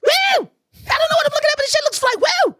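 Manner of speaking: high whooping cheer, bluffing excitement; clueless crowd whoop. Retold or imitated. Dancer mimics himself in the crowd, high excited whooping. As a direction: whoop and cheer without knowing what you saw. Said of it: "And I'm like".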